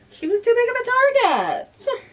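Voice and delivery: high, loud outburst from a character; indignant protest. A narrator's reading. Coco defending her dodgeball pick, indignant. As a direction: cry it out in loud, high-pitched self-defence.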